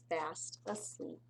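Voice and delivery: usual speaking voice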